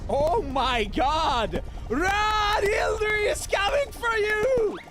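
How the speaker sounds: high, loud drawn-out scream of warning; panicked shouting. Acted. Player yells for Hildy to run from danger, panicked shouting. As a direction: scream a warning with all you have.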